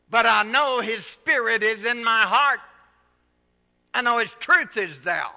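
loud, high, rousing preacher's cry; full-force preaching. Acted. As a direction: lift the congregation, preaching hard.

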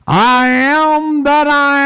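high, slow, booming divine proclamation; voice of God. Acted. Preacher voicing God to the congregation, slow and exalted. God's words quoted in sermon.

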